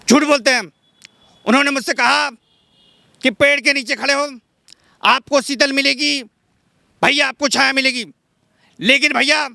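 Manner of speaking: high-pitched politician's speech in short bursts; high, halting oratory impression; Rahul Gandhi. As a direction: pause after each phrase, pitched well up. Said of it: "Rahul Gandhi अगर बोले तो राहुल गांधी कैसे बोलेंगे?".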